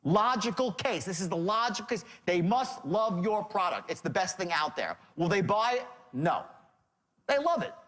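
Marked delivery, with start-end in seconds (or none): none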